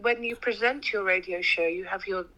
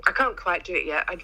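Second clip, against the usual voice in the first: ordinary talk, nothing marked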